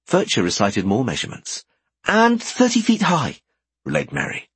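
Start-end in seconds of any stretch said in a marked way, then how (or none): 2.0-3.4 put-on higher, brighter girl voice; young woman voice. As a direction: play Merry, lively. Merry relays.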